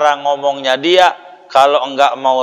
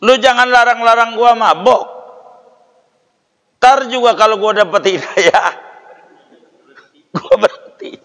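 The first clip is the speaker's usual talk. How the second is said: put-on drunkard's excuse; 8 semitones higher than usual. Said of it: "Tuh ngomong begitunya tuh".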